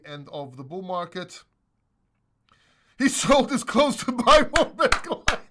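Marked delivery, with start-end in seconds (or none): 3.0-5.4 laughing. Real, not acted. Laughing at a chat joke.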